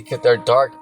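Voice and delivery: ordinary talk, nothing marked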